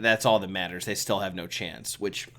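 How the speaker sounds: ordinary talk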